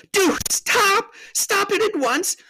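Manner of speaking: very high, exasperated scolding; high, indignant girl's outburst; bawled scolding. A narrator's reading. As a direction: scold a blubbering friend at high pitch. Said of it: "bawled Lucy in his ear, shaking him".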